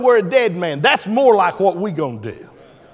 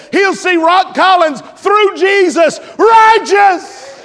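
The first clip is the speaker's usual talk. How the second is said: much higher, louder, shouted preaching; full-force preaching; 7 semitones higher, 5 dB louder than usual. Acted. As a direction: preach it with everything you have.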